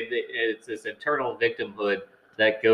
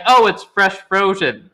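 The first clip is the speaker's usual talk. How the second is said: defensive restaurant owner; 8 semitones higher, 5 dB louder, slower than usual. Retold or imitated. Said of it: "where they're like".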